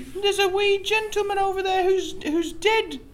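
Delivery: lady voice. Acted. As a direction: play the flustered lady witness.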